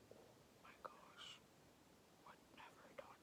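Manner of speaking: whispered, barely a breath of shock; hushed whisper. Retold or imitated. Retelling the girl's regret after he leaves, in a whisper. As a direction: breathe it out as if horrified at herself.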